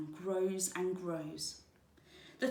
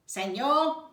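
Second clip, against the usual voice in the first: high, loud squawk; squawking toucan; 6 semitones higher, 10 dB louder than usual. Acted. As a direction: squawk it like a bird. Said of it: "squawked the toucan".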